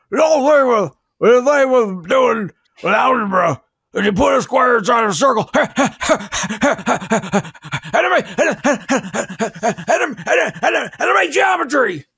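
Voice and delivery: high, blustering impressionist bit with laughing stammers; exaggerated comic impression, stuck on the words; Frank Caliendo. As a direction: sputter excitedly in a hammed-up high voice. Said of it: "watch a Frank Caliendo impression".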